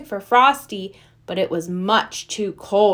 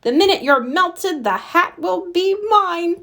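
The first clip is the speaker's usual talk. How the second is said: villain yell; 8 semitones higher than usual. Acted. Professor gloats at the trapped pair, mock yell. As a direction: gloat it through the shut door, hammed-up. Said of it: "Professor Hinkle yelled".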